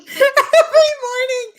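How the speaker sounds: very high, loud, drawn-out squeal; hammed-up high-pitched cheer; mock morning chirp. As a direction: laughingly sing it out like a wake-up call. Echoes her own imitated morning greeting.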